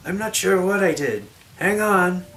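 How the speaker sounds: high, loud, alarmed voice; hammy stage actor. Acted. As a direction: call it out in alarm. Amira alarmed on carpet.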